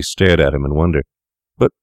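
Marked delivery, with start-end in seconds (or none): 1.6-1.7 high, rising, stammering outburst; frantic cry. A narrator's reading. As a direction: blurt it out in panic. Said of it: "cried the other".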